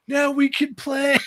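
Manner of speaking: high, loud, drawn-out mock triumph; excited cheer. Dad unveiling the stashed game at last, excited shout. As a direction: shout it out slowly and high, gleefully.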